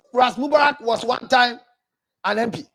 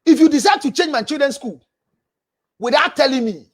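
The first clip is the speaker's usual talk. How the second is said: ordinary talk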